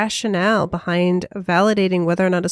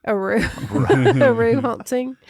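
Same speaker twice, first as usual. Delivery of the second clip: badly faked Aussie accent, dissolving in giggles; slow, clumsy try at mock Australian; giggly stab at an Australian "roo"; failed Australian attempt; 2 semitones higher than usual. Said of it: "I don't even know why I tried".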